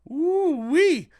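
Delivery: excited whoop. Real, not acted. Reacting to the headline.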